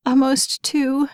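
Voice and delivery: high, slow tearful answer; crying mother forcing out the words. A narrator's reading.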